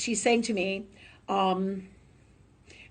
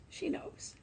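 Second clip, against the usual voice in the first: whisper; 14 dB quieter than usual. Real, not acted. Unvoiced repeat.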